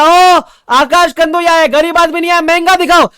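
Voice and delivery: mock rich customer yelling for the costliest laptop; hammed-up grand boast. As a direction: bellow it like an over-the-top rich man. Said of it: "मैंने बोला".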